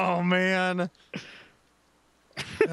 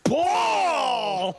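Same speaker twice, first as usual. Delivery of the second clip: loud, high umpire yelling ball; excited umpire call; 9 semitones higher, 3 dB louder than usual. Retold or imitated. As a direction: bellow the call like an overeager ump. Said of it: "Like he's just so excited".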